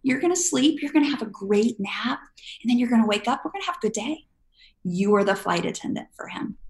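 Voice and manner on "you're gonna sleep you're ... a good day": put-on bright, higher, upbeat promise to a toddler; cheerful reassuring parent. Parent cheerfully telling toddler it's nap time, bright and upbeat. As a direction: sell the nap like it's a treat. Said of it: "Or you're like".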